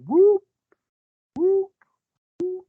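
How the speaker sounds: high, loud hooting whoop; three high hooting whoops, imitating a Sasquatch; imitated whoop call. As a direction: mimic a far-off creature's whoop call. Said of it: "we heard three whoops".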